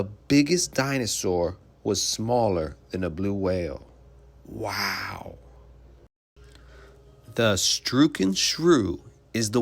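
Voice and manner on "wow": hushed wow. Acted. Marvelling at blue whale size.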